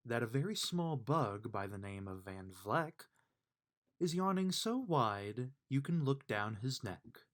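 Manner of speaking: ordinary delivery, nothing marked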